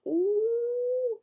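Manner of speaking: very high, long drawn-out "ooh"; gleeful ooh. A narrator's reading. Fox spots the bear under snow, high gleeful ooh. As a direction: hoot it high and sustained.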